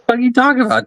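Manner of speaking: ordinary talk, nothing marked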